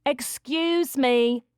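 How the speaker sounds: high, drawn-out interrupting call; woman calling out. A narrator's reading. Producer calling to an intruding little girl, high and drawn out. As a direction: call slowly to stop someone. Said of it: "a woman's voice called".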